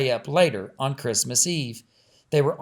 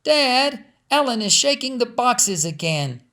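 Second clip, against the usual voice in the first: high, whiny tattling; complaining little girl; 9 semitones higher, 3 dB louder than usual. Acted. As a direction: tell on her brother to Dad. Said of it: "Lizzie complained".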